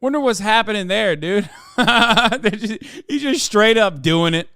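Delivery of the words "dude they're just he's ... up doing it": ordinary delivery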